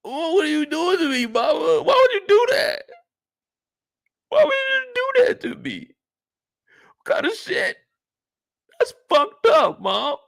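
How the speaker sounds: high, wailing protest to his mother; anguished teen son. Acted. Kanan confronting his mother over his dad, anguished high wail. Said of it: "You know Kanan is thinking".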